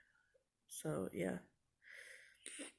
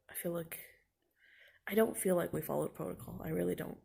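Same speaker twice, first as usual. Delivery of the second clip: normal talking voice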